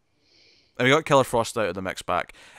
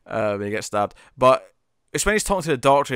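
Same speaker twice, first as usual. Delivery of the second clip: ordinary talk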